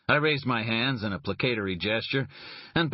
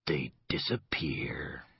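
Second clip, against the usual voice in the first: very slow, much softer frightened whisper; 5 semitones lower, 5 dB quieter, much slower than usual. Acted. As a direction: barely voice it, scared of the words. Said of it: "what he saw frightened him".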